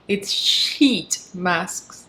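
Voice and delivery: "sheet masks" very slow, soft and deliberate; careful pronunciation. Shown as a demonstration. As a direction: spell out "sheet masks" slowly. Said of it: "It's not poop".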